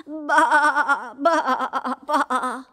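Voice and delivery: wavering sheep baas; bleating sheep. Acted.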